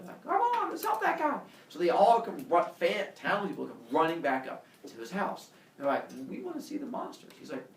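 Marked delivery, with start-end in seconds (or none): 0.2-1.4 high-pitched townsperson voice; rallying townsperson. Retold or imitated. 6.3-7.3 high, soft townsperson voice; eager townspeople. Retold or imitated. Townspeople pleading in put-on voice.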